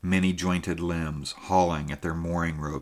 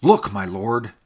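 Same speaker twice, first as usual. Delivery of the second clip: louder, insistent call to the lord; urgent cry; 3 semitones higher, 6 dB louder than usual. A narrator's reading. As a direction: repeat the warning more urgently. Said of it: "cried the voice of the gaunt man".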